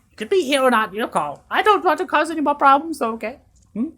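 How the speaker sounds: high, loud fussy character voice; high NPC voice. Acted. GM voicing the worried NPC to the player, high and loud. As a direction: answer in the NPC's high fluster.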